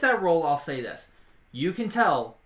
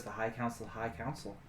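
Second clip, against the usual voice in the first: softer, offhand shrugged reply; dismissive shrug; 3 semitones lower, 9 dB quieter, faster than usual. Acted. Woman shrugging off his doubts about the council, offhand. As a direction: shrug it off quietly, as if that settles it. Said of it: "just kinda shrugs and she goes".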